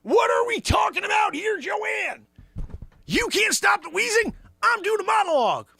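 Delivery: high, loud character shout; shouting Zabrosk. Acted. Sidekick Zabrosk yelling at Joanne about the monologue. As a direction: bellow it out as the brash sidekick.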